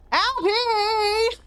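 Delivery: high, bright streamer greeting voice; cute anime streamer. Acted. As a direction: squeal hello to the raiders. Greeting raiders, switches to stream voice.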